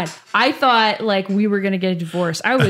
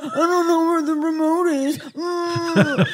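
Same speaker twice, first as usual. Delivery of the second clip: dopey husband voice; 8 semitones higher, slower than usual. Retold or imitated.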